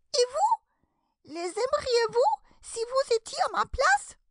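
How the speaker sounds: very high squeaky, indignant questioning; piercing, angry mouse. A narrator's reading. Mouse challenging Alice to take its place, piercing and angry. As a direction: challenge her in a furious little mouse squeal. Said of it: "d'une voix perçante et colère".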